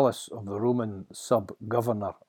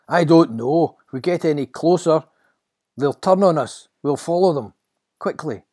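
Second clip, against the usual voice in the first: louder, raised, pressing voice; urgent Peter; 6 semitones higher, 11 dB louder than usual. Acted. Peter to the helpless disciples, urgent. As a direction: urge your friends to follow.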